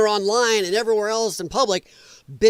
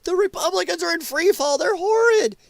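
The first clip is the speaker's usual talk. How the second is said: high-pitched mocking quote of politicians; mock press-conference alarm; 11 semitones higher than usual. Mocking Democrats at their press conferences, high alarmed voice. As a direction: parrot their outrage, pitched well up. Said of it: "they're out doing press conferences".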